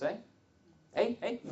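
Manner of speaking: put-on jokey Canadian accent, tagging a high rising eh. Joking to the seminar audience, mock Canadian accent. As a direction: toss out a playful Canadian eh, hey. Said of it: "That was a Canadian accent".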